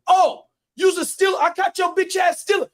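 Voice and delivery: high-pitched yell at a thief; high squeaky yelling voice. Retold or imitated. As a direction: shriek it like an angry movie character. Acting out movie scene.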